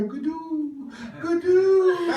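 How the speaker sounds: high, drawn-out call for a lost brother; calling child. Retold or imitated. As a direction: imitate a little boy calling out. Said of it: "looking for his older brother".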